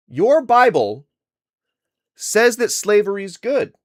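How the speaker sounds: emphatic. Real, not acted. Streamer confronting a caller about the Bible, emphatic. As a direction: hit it hard in accusation.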